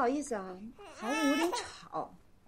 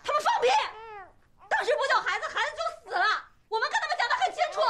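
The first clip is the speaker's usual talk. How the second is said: high-pitched indignant shout; furious, high-voiced rebuttal; angry outburst; 8 semitones higher, faster than usual. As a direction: burst out in outrage, pitch jumping up. Mother rebuts the hospital's accusers.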